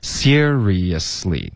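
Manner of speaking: seriously slowly as SEER-ree-yus-lee, joined up; smooth slow model of seriously in four linked syllables; connected syllables. Shown as a demonstration. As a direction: link every beat of seriously, none dropped. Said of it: "连贯的".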